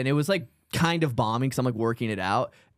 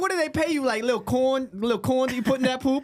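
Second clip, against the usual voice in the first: mock comic voice; 12 semitones higher than usual. Mocking the comic together.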